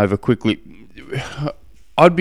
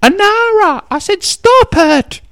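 very high, loud mock yell, stretched out; high-pitched kid shouting, dragging the words; imitated child yelling out; 6 dB louder, slower than usual. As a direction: holler it like a fed-up kid at his sister. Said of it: "yelling out".

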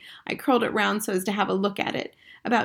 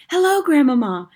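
ordinary delivery, nothing marked

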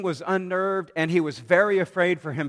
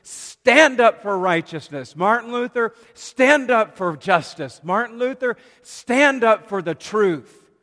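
commanding inner voice; 6 semitones higher, 6 dB louder than usual. Retold or imitated. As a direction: thunder each "stand up" like a summons. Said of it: "I could hear an inner voice saying".

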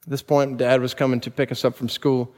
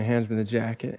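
ordinary delivery

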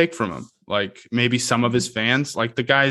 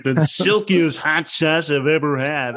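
slow, hammed-up ad pitch, pitched higher; over the top; 6 semitones higher, slower than usual. Shown as a demonstration. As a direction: oversell it like a cheesy commercial. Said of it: "you also don't wanna go over the top".

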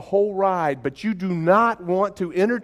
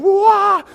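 loud, high "wah!" yell; hammed-up scream on the plunge; mock roller-coaster scream; 9 dB louder than usual. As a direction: yelp as if the ride just dropped. Mimics scream as the coaster drops.

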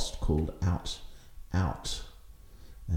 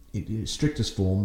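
ordinary talk, nothing marked

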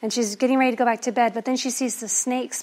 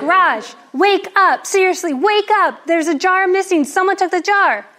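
higher and louder urgent yelling; frantic shout; 7 semitones higher, 7 dB louder than usual. Acted. Wife still shouting to wake husband, frantic and loud. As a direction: yell at him to get up now.

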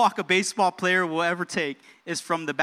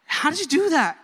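high, mock-astonished question; amazed teammate; 9 semitones higher, 5 dB louder than usual. Teammates to Babe Ruth after the homer, mock amazement. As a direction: imitate a teammate marvelling, pitch jumping up.

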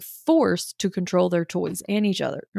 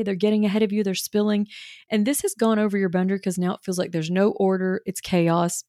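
normal talking voice

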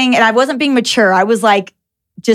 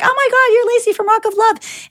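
high, squealing recognition; excited fan; 12 semitones higher than usual. Retold or imitated. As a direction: gush like a starstruck fan. Fans at airport recognize her.

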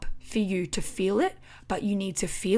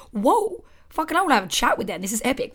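amazed whoa; 5 semitones higher, 5 dB louder than usual. Retold or imitated. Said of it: "I'm like".

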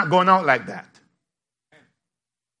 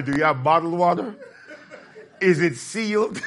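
laughing. Real, not acted. Comic voiced picky guest.

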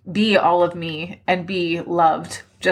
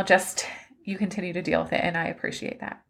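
softer, nearly whispered thanks through tears; fading tearful; 8 dB quieter than usual. Real, not acted. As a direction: let it fade to a teary breath. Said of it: "I'm already crying now".

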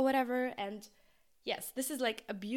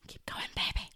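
whispering. Acted. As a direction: whisper it to yourself. Whispered encouragement to herself.

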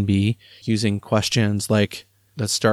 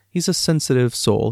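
ordinary talk

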